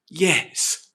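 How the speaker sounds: ordinary talk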